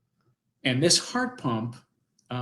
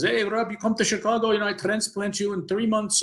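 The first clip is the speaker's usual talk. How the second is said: imitating a doctor's thick Polish accent, higher; 8 semitones higher than usual. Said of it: "he has this thick Polish accent".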